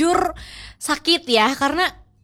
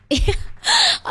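a much higher squealing laugh of delight. Real, not acted. Reacting to the professor's praise with a high squealing laugh. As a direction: squeal with flattered joy.

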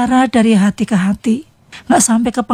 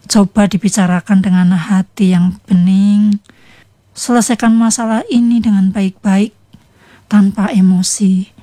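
usual speaking voice

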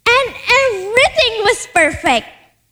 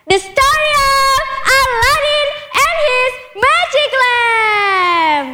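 higher, drawn-out storyteller's flourish; grand title announcement; 4 semitones higher, slower than usual. A narrator's reading. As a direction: announce the tale and stretch the last word.

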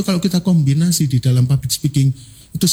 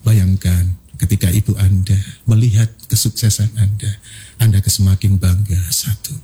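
falling reflective tone; 9 semitones lower than usual. Shown as a demonstration. Trainer showing falling tone for reflection, low and hushed. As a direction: draw them inward like a hypnotist. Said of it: "nada turun digunakan untuk renungan".

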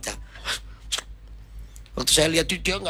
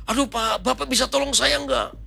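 high, distressed pleading; acted caller begging for help; panicked plea; 10 semitones higher than usual. Frightened congregant begs the pastor for help, panicked plea.

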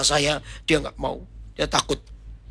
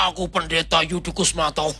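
hammy ketoprak theatre. Pretend. Pastor jokes about answering the demon, hammy ketoprak boast. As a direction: declaim it, then burst into theatrical laughter. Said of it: "Itulah ketoprak".

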